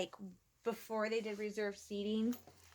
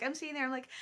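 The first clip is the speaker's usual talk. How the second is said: ordinary talk, nothing marked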